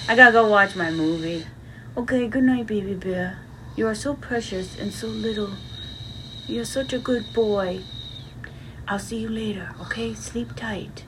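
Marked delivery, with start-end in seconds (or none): 2.0-7.8 soft, tender goodnight to a little one. Acted. Tucking baby bear in. 8.9-11.0 soft whisper so he stays asleep; whisper, don't wake him. Acted. Child asleep, sneaking away.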